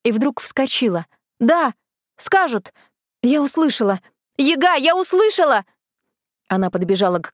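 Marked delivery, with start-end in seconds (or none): 1.4-5.7 excited outburst. Acted. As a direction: burst out with sudden joy. Fairy jumps up, realises.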